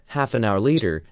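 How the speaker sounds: usual speaking voice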